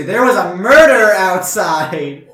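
loud, slow, drawn-out insistence; exasperated shout. Acted. Robot feels crazy insisting on murder, exasperated and loud. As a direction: spell it out loudly for people not listening.

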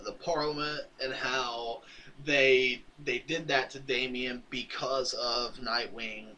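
ordinary delivery

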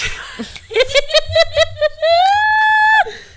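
high screaming cackle; shrieking laugh. Real, not acted.

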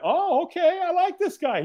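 high-pitched, delighted realisation; pleasantly surprised relief. Retold or imitated. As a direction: lift it right up, won over.

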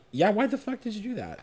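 fast, high incredulous. Retold or imitated. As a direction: blurt it out in disbelief. Reacting to him hitting himself.

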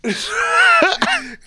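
very high, loud burst of laughter; real shrieking laugh. Real, not acted. Host cracking up at their own awkward reply, high loud laughter. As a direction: lose it completely in a high laugh.

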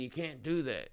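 normal talking voice

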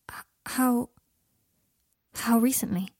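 stammered, halting question; taken aback. A narrator's reading. Harry startled by Draco's confession, stammering. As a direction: stall on the first word, then ask.

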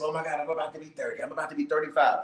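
ordinary talk, nothing marked

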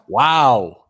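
loud, stunned wow; amazed wow. Real, not acted. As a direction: blurt it out in disbelief. Host amazed by duck voice.

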